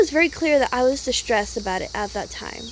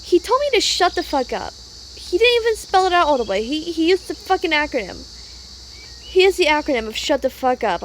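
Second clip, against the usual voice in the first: angry venting; 5 semitones higher than usual. Real, not acted. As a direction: let the anger spill out fast. Venting about rude texts.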